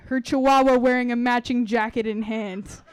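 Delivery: plain reading voice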